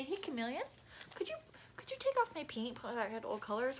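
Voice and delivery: gentle polite ask; polite request. Shown as a demonstration. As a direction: show the nice way to ask. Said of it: "You could say".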